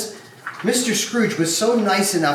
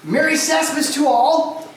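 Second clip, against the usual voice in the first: hearty greeting; 10 semitones higher than usual. Acted. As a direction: belt out a festive welcome.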